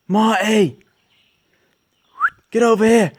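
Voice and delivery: loud, high call across the distance; calling the dog. Real, not acted. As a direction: yell for the dog to come back.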